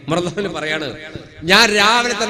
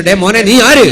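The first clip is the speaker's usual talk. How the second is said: louder demand, squaring up; loud challenge; 2 semitones higher, 10 dB louder than usual. Retold or imitated. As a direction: shout him down. Quarrel escalating. (ഉറക്കെ വെല്ലുവിളിക്കുന്നു)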